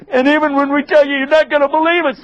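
high, whiny excuse; high whining dodge; evasive brothers. Acted.

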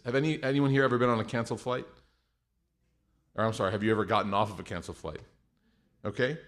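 normal talking voice

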